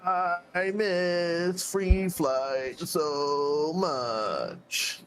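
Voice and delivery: slow, drawn-out mock longing; facetious. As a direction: stretch it out like a fake lament. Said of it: "I'm being facetious".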